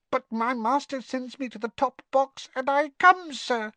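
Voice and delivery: high, dutiful squeak; nervous house elf. A narrator's reading. Winky explains obeying her master, nervous squeak. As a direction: squeak bravely through your fear of heights. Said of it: "glanced towards the edge of the box and gulped".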